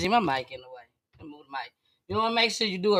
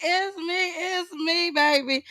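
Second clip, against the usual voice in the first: high diva voice; 10 semitones higher than usual. Acted. Host's high character greeting.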